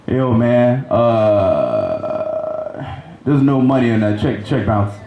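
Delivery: low, slow-to-react mock caller voice; caller impression. Man calling comic about a bounced check, imitated low. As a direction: drop way down to imitate the man on the phone.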